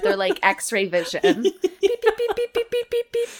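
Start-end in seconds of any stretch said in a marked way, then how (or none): 2.0-3.3 high repeated electronic beeping; reversing truck beeps. Acted. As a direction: beep like a sensor. Mimicking sensor beeps.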